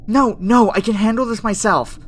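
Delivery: loud, high, flustered refusal; urgent protest. Acted. Scientist stopping the AI's termination protocol, urgent protest. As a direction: cut in urgently to stop it.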